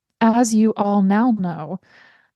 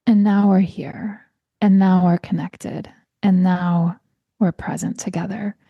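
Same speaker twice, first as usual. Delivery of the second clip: guided breathing. Acted. Leads a breathing exercise.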